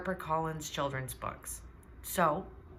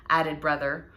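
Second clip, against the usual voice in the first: plain reading voice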